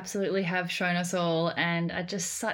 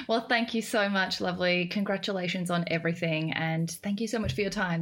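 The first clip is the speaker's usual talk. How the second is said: ordinary talk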